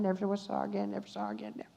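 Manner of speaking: soft, trailing "never saw her again"; fading repetition. Real, not acted. Repeats phrase, fading before emphatic take.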